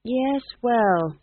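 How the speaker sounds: high, hesitant doubt; doubtful young witch. A narrator's reading. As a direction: concede it doubtfully, pitched high. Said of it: "said Magrat doubtfully".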